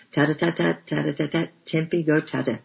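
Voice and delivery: put-on high bouncy child chant; a little child begging to go out; playful toddler voice. A narrator's reading. Child playing at going out.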